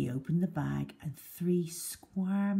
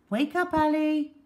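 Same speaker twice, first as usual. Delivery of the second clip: calling to wake him; 12 semitones higher, 6 dB louder than usual. Acted. Nurse rousing injured boy.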